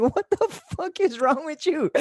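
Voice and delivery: high, disbelieving half-laugh; asking a friend what's wrong with him; incredulous. Retold or imitated. High incredulous retelling.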